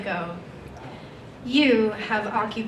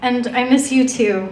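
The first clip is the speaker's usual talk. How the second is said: louder, firmly delivered answer; emphatic reply; 6 dB louder than usual. Acted. Poet answering a loved one's letter, emphatic. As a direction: push the reply out with more force.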